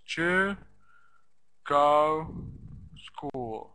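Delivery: Ju, go, school as separate strong beats; stressed syllables alone. Shown as a demonstration. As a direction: isolate Ju, go and school, pausing between them. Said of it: "What's important are the stressed syllables".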